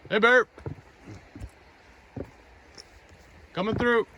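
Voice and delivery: loud high call to warn bears; scaring off a bear. Real, not acted. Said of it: "Just like to make noise coming through".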